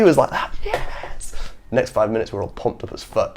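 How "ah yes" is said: delighted cry. Retold or imitated. Teacher delighted by noise.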